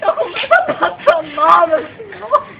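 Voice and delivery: mother sobbing to the policeman about the stolen money. Acted. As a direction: wail it out like a distraught mom begging for help. Mother crying, telling of illness.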